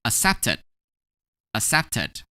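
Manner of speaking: "accepted" with a sounded final syllable; ed as extra syllable. Shown as a demonstration. Teacher modelling "accepted" for learners, ed as its own syllable. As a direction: demonstrate "accepted", ending in a separate ed syllable. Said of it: "we're gonna pronounce it as".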